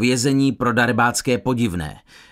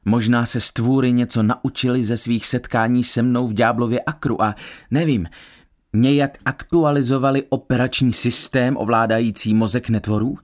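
ordinary delivery, nothing marked

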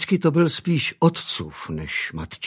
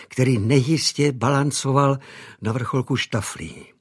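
ordinary delivery, nothing marked

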